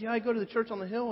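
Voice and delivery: high, bright-eyed gushing coworker; eager Billy voice. Retold or imitated. Billy beaming at the new guy over his hat, high eager voice. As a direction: pitch it up like a proud, beaming church man. Billy boasting about church.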